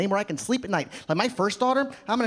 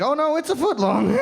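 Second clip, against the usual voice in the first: higher, lightly voiced flustered clerk; flustered counter guy; 6 semitones higher, 4 dB louder than usual. Counter guy answers the sultry customer, flustered. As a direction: play a clerk overcome by the customer.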